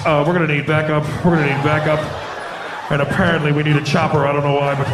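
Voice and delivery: low, level radio call; police officer. Acted. Officer calling for backup, deadpan.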